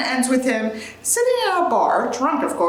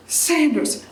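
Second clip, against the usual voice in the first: ordinary delivery, nothing marked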